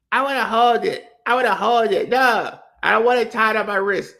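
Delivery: mock whiny toddler, loud and insistent. Comic imitating a toddler refusing the wrist string, loud whine. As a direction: act out a demanding little kid. Baby whining about the balloon.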